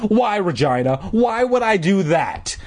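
rising, incredulous questioning; sarcastic. A narrator's reading. Boy challenging his sister, sarcastic. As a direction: let the pitch climb with sarcasm. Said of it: "Todd cried sarcastically".

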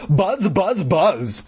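buzzing shout, high pitched. A narrator's reading. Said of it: "I shouted as loudly as I could".

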